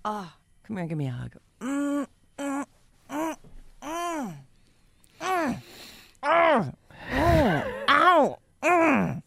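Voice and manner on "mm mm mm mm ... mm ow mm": hugging hums and a pained ow; squeezing hug hums. Pretend. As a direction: hum while squeezing hard. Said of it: "You're squishing me".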